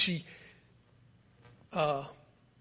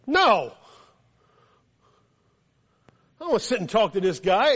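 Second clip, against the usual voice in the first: loud, higher-pitched balk; balking refusal; 4 semitones higher, 8 dB louder than usual. Retold or imitated. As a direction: refuse outright, raised voice. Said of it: "I'm like".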